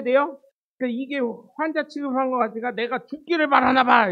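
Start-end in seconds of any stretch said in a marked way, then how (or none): 2.7-4.1 loud hammed-up indignation; mock anger. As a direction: act out a mother-in-law's angry outburst. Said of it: "막 화를 내는 겁니다".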